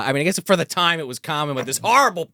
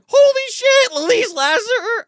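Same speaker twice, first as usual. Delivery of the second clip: very high, loud mock shriek; hammed-up starstruck yell; astonished exclamation; 5 dB louder, slower than usual. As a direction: draw out the name in amazed recognition. Retelling own astonished reaction.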